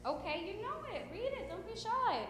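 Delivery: soft, high, reassuring nudge; gentle coaxing. Real, not acted. Teacher coaxing shy students to read.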